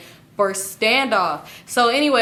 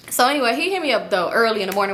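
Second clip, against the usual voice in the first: usual speaking voice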